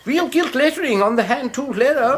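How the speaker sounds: high, fawning shopkeeper's voice; oily old shopkeeper. Acted. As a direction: greet the customer like an unctuous old dealer. Shopkeeper character voice.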